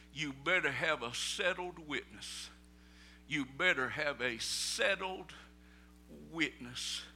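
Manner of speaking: usual speaking voice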